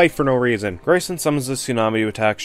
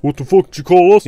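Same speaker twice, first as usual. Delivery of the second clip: louder, higher hammed-up snarl; mock angry retort; 6 semitones higher, 7 dB louder than usual. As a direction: spit the insult back like a cartoon villain. Villain snapping at the heroes.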